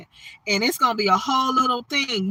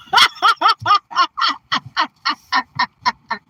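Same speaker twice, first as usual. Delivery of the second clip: long, loud, high cackling laugh; big laugh; 6 semitones higher, 5 dB louder than usual. Real, not acted. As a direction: burst out laughing. Hard laugh after viewer comment.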